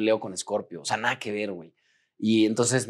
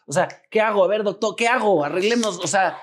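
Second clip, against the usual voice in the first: enojo y frustración; 6 semitones higher than usual. Retold or imitated. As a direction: act out impatient, exasperated demands, pitch climbing. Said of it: "estaba enojado y frustrado". (frustración desesperada, voz cada vez más aguda)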